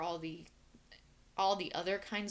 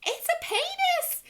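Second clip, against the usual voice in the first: shrill high Southern shriek, slow and loud; Southern accent scream; 10 dB louder, slower than usual. Retold or imitated. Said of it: "screamed at the top of her voice in a very, very strong Southern accent".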